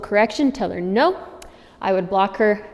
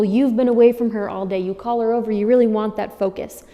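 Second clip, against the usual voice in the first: ordinary talk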